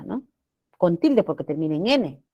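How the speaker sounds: normal talking voice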